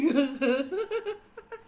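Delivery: real burst of laughter; loud laugh. Real, not acted. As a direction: let out a big laugh. Laughs after mocking joke. (tawa lepas)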